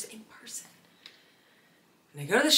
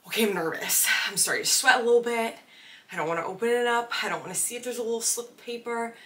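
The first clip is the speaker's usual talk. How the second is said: mock nervous panic; 6 semitones higher, 5 dB louder, faster than usual. Retelling nerves before opening playbill.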